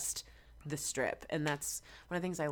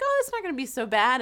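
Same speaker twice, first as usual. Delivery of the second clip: higher, louder, carefree brush-off; breezy confidence; 8 semitones higher, 9 dB louder than usual. Retold or imitated. Singer recalls her naive arrival in hot Vegas, breezy and carefree. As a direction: shrug it off cheerfully.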